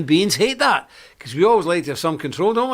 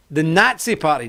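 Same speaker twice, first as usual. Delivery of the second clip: ordinary talk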